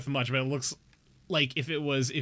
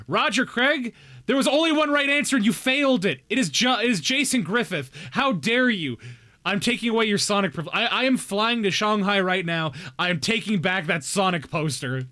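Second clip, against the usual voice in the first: loud, higher hammed-up indignation; mock outrage; 5 semitones higher, 4 dB louder than usual. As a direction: scold the chat in playful fury. Mock outrage at chat's answer.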